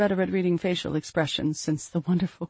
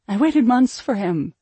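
"months" pushed in a slow, loud, raised line; pained insistence; 6 semitones higher, 6 dB louder than usual. A narrator's reading. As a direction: lean hard on "I waited months", slowly and louder. Mother insists father never came.

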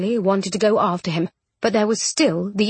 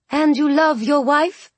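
high, slow, pointed question; loudly; 6 semitones higher, 3 dB louder, slower than usual. A narrator's reading. Missus Rochester reminding her husband, loudly. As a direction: butt in emphatically, stretching it out. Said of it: "Missus Rochester added loudly".

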